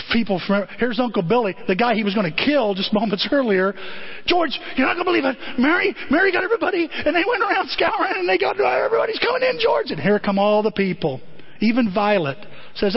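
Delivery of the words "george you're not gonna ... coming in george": high, fast excited rush; breathless excitement. Retold or imitated. Uncle Billy rushes the news to George, high fast excited. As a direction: tumble out the good news.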